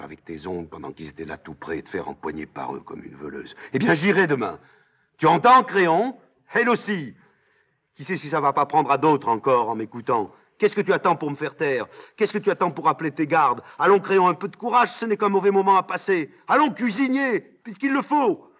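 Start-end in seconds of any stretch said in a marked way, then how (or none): 3.7-4.6 loud, high declaration; defiant vow. Acted. Ismène se révolte à son tour. 5.2-18.5 high, loud taunts building to a shout; frenzied goading. Acted. Antigone provoque Créon jusqu'au bout.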